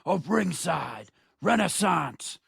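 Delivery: slow, punched ring-announcer intro with big pauses; show-opening announcer. Acted. Host introduces the show and tag team partner, announcer style. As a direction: announce each name like a main event.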